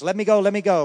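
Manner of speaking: eager plea. Retold or imitated. Girl eager to walk alone.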